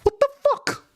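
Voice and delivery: high exasperated cry of disbelief; exasperated outburst. Real, not acted. Streamer fighting OBS chat settings, exasperated. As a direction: throw up your hands at the screen. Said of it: "that is absolutely frustrating".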